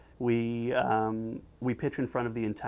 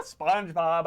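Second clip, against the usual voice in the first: higher, mildly exasperated call; getting angry; 5 semitones higher than usual. Acted. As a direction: try to break through the noise, irritated. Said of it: "now Squidward's getting angry".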